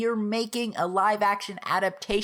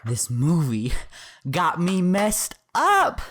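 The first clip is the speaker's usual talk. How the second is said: exasperated; 3 semitones lower, 4 dB louder, slower than usual. Real, not acted. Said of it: "I'm just gonna yell at the camera".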